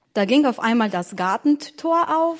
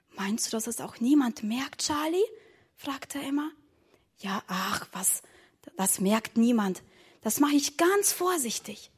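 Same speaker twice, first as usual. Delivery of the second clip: hushed voices; 7 dB quieter than usual. Acted. Secret talk at pantry window.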